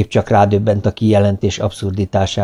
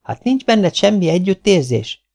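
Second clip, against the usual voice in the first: high-pitched, mild outcry; wife's understated exclamation; exclaimed; 10 semitones higher than usual. A narrator's reading. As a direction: appeal to him in a raised pitch. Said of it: "kiáltott fel a lány".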